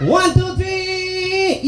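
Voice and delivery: drawn-out high count through laughter; slow shouted countdown. Acted. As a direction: call each number slowly. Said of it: "game host countdown".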